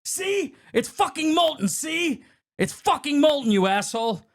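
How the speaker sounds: high, insistent, worked-up tirade; acted furious rant. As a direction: build from lecture to high, hurt rage. Acts out chef's angry monologue.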